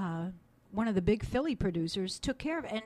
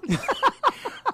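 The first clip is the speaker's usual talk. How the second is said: high loud laugh; 14 semitones higher, 9 dB louder than usual. Real, not acted. Laughs at own joke.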